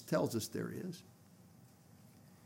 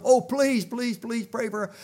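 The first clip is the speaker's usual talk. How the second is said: urgent, higher pleading; pleading women; 6 semitones higher, 4 dB louder than usual. Retold or imitated. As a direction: beg him to pray, voice lifted. Women beg the preacher to pray.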